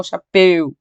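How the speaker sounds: "chapéu" said wrongly with a narrow closed e; closed-vowel mispronunciation. Shown as a demonstration. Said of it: "não chapéu".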